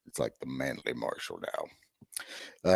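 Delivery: gravelly voice. Real, not acted. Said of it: "I got a gravelly voice".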